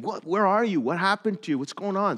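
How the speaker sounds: high, loud, urgent questions; voicing the worried gang members; frantic calling. Gang members calling the missing leader, loud and high.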